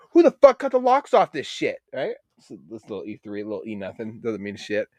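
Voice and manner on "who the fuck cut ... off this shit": much higher, louder indignant yell; mock outrage. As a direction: shout it up high, fed up. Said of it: "I'm like".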